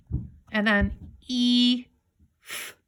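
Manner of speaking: TH, E, F as separate sounds, "th... ee... fff"; sounding out. Shown as a demonstration. Teacher breaking a word into sounds, slow separate TH, E, F. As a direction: sound out TH, long E, F slowly with gaps. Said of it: "T H makes the sound".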